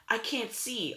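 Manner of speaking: high-pitched cry of alarm; panicked yell. A narrator's reading. Three sisters suddenly blind, yelling in panic. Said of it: "all three sisters yelled".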